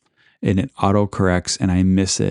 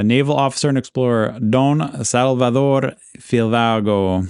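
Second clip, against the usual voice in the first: retried Spanish accent; 3 semitones higher than usual. Shown as a demonstration. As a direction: roll the Spanish names like a native speaker. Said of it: "I gotta work on my Spanish accent".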